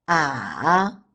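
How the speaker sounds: "ah" dipping low and coming back up; falling-rising tone. Shown as a demonstration. Teacher leads repeat drill, dipping ah. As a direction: sink then lift a long "ah". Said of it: "The third tone is the falling rising tone".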